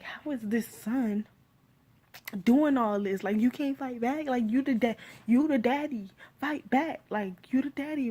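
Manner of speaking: venting at a helpless father on screen; exasperated outburst. Retold or imitated. Vlogger yelling at the movie's father, exasperated and loud. Retelling her reaction to the movie.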